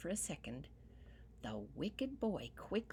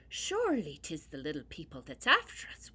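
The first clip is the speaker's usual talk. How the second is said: ordinary delivery, nothing marked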